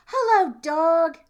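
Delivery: high, loud chirpy duck greeting; cheery duck. Acted. Duck greets the cow, high and loud. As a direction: sing out hello like a happy duck.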